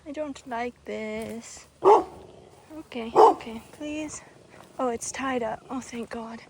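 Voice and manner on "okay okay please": scared pleading. Real, not acted.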